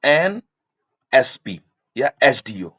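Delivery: NSP या SDO drawn out letter by letter; slow spelling demo. Shown as a demonstration. Teacher slowly spells out the example. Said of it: "अब जैसे".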